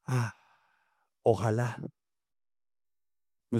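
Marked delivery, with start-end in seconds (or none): 0.1-1.8 sighing. Shown as a demonstration. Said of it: "estuviese diciendo".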